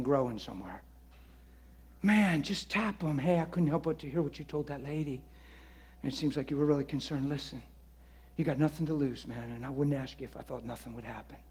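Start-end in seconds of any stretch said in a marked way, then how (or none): 3.2-11.4 quiet, fast, gentle offer; offering to pray for a worried stranger, softly; soft gentle invitation. Shown as a demonstration. Modelling a gentle approach.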